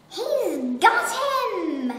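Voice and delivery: slow, loud triumphant shout; monkey crying out in triumph. Acted. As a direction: announce the catch. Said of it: "cried the monkey".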